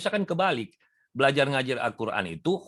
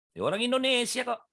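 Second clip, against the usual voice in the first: ordinary delivery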